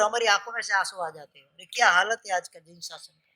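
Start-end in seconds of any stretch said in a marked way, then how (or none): none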